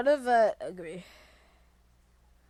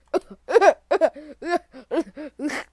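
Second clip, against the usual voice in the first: high, loud burst of laughter; 4 semitones higher, 10 dB louder than usual. Real, not acted. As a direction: laugh out hard at the game. Laughing while playing.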